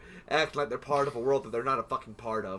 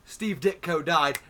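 ordinary talk, nothing marked

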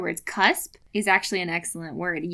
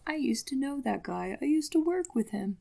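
lightly voiced, prim radio tone; a hint of a frosty NPR voice; prim self-impression; 4 semitones higher, 3 dB quieter than usual. Mocking future self as a prim NPR voice. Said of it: "a perfect impression of you".

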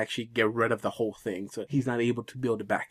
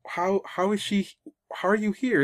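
surprised; 8 semitones higher than usual. Retold or imitated. Mike shocked at Boo.

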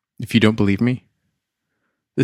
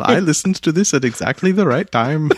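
lightly put-on Scandinavian accent; a vague Scandinavian accent for a fan email; 6 semitones higher than usual. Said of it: "I don't know what kind of accent I'm doing".

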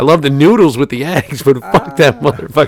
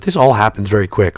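ordinary talk, nothing marked

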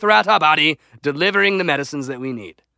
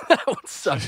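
slow echo through a laugh, softer; laughing agreement; 5 dB quieter, slower than usual. Real, not acted. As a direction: chuckle out the agreement, drawn out. Echoes the verdict while laughing.

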